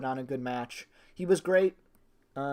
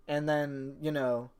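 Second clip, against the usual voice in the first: ordinary talk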